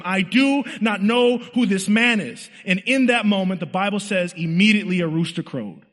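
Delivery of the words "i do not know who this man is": high, slow, emphatic oath; acted vehement denial, pitched up and drawn out; sworn denial. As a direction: swear it to the whole group, stretching the words. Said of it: "he swears to them".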